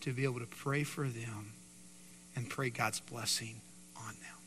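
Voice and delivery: soft, hushed prayer; quiet prayer. Acted. Pastor praying for a humble heart, softly and sincerely. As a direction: pray gently over the room.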